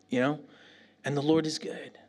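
usual speaking voice

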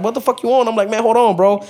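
high-pitched, indignant pushback; retold irritated challenge. He pushes back on his homie outside, retold irritation.

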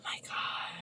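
breathless whispered "oh my god"; awed whisper. Retold or imitated. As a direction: whisper it in awe at first sight of the baby. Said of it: "I was like".

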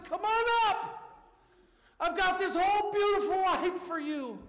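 a lightly voiced loving God, high and loud; God calling up. Preacher voicing God inviting people upstairs, lightly voiced call. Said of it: "when God says".

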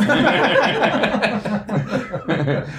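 high, loud, laughing through words; laughing in disbelief. Real, not acted. Laughing reply to teasing.

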